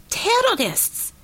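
high-pitched, drawn-out alarm shout. A narrator's reading. Korovin reacts first to what she has seen, shouted. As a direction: cry out the warning, stretching the word. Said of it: "he shouted".

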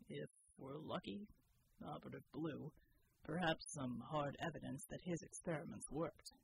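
low, steady, quiet; matter-of-fact, deadly serious; low grim soldier. A narrator's reading. Bull's low voice.

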